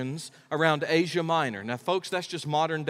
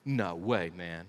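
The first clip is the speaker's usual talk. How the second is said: dismissive scoff; 10 semitones lower, 7 dB quieter, slower than usual. Retold or imitated. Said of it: "And Peter's like".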